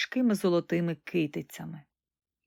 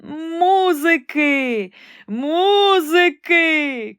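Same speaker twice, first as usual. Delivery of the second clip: much higher, louder, drawn-out shout; frantic cry; 8 semitones higher, 8 dB louder, much slower than usual. Acted. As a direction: howl for music in panic. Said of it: "кричав імператор". (несамовитий крик)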